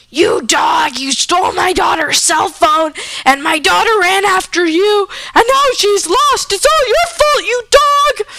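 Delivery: hammed-up furious mom, climbing to a very high shout; acted angry yelling. Mom blaming the dog for her lost daughter, angry yelling.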